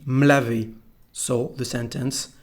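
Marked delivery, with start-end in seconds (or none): 0.1-0.7 "me laver" said m'lavé, no E; contracted me. Shown as a demonstration. Teacher repeating for learners, "me laver" said as m'lavé. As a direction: glue the m to "laver". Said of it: "without the letter E".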